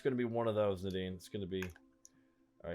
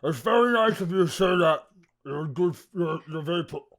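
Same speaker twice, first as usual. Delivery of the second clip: higher, louder flustered; flustered character voice; 6 semitones higher, 8 dB louder than usual. Acted. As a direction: gush it out awkwardly.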